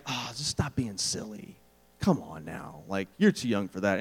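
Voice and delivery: low, soft parental brush-off; dismissive. Acted. Parent brushing off a teenager's romantic longing, low and dismissive. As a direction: wave it away with a low, sighing tone.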